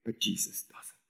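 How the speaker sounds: soft whispered contrast. Real, not acted. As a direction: drop to a whisper for the point. Contrast after we forget.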